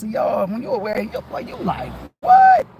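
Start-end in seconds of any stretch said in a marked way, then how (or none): 2.2-2.7 sharp high shocked yelp, much louder; surprised what. Retold or imitated. Realizing something about himself, a startled high cry. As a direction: jolt at a sudden discovery. Sudden self-realization exclamation.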